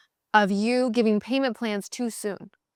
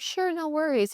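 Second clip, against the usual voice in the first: people-pleaser; 7 semitones higher, 3 dB quieter than usual. Retold or imitated. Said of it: "And you're like".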